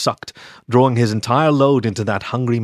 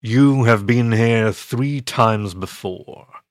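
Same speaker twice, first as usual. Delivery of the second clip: slow, deep monster voice, every word weighed; deep imposing voice; much slower than usual. Acted. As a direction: loom over him with a slow, imposing rumble. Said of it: "a deep, imposing voice said".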